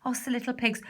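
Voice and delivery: plain reading voice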